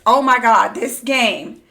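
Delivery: loud, thrilled oh my God; loud excited gush. Real, not acted. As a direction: burst out with delight. Thrilled by game depth.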